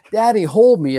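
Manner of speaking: lightly voiced little child reaching up to daddy; childlike plea. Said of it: "as a child would do".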